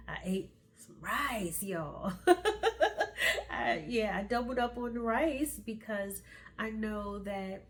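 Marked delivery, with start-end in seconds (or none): 2.2-3.4 high, loud burst of giggles; real laugh. Real, not acted. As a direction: laugh out loud at your own confession. Laughs about doubling up on rice.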